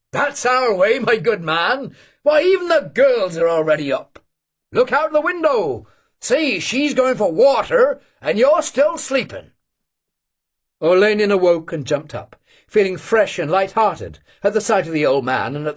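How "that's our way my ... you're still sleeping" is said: put-on old hunter teasing. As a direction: chide the late sleeper.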